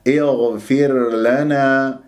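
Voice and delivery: slow اغفر لنا, the r fully sounded; clear ra. Shown as a demonstration. Teacher showing what must be clear, slow recitation. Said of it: "يجب أن تظهر".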